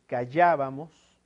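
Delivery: callábamos, weight on the second syllable llá; stress on antepenultimate syllable. Shown as a demonstration. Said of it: "lo lleva en la antepenúltima".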